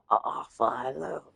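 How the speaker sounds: soft half-hearted impression; Tom Hardy impression. Another try at the impression, soft Tom Hardy voice. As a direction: quietly attempt the character greeting. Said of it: "I'm trying not to laugh".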